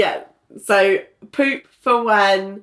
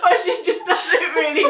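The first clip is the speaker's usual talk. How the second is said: laughing high; 10 semitones higher, faster than usual. Real, not acted. Co-host laughing at the scene.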